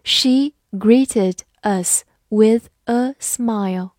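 slow, clear model reading. Shown as a demonstration. Teacher reading slowly for students to repeat. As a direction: stretch each word out slowly. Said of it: "好，慢慢来读".

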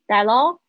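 "That all" run together like "tha-lah"; loud linked "That all" with rising end; linked flapped T. Shown as a demonstration. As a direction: soften the T in "That all" to an L-like flap. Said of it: "会浊化变成好像了的声音".

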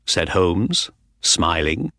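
usual speaking voice

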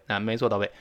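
usual speaking voice

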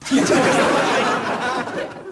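high, breathy burst of laughter; laughing. Real, not acted. Host laughing at his own teasing, real laugh. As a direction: laugh at your own joke.

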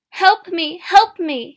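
high, very loud plea; desperate shout; calling for help. A narrator's reading. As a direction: call out urgently for help. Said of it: "she called to her husband".

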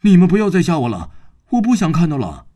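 high, fast, frightened yelling; panicked shout. Acted. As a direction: cry out in terror, fast and high. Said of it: "突然狂发大叫".

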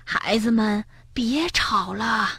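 soft, slow grandmotherly plea; gently pleading granny. A narrator's reading. Granny to squabbling children, gently pleading. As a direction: draw it out softly, asking for calm.

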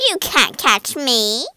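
loud, teasing witch voice; witch's taunting shout. A narrator's reading. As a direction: shout a taunt as you escape. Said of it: "she shouted".

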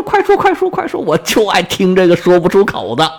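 high-pitched, gossip-hungry prodding; eager urging. Acted. King urges old prince to spill the secret, eager urging. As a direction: squeal with eagerness to hear the juicy secret.

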